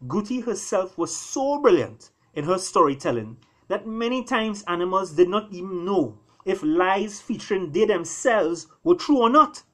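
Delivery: ordinary delivery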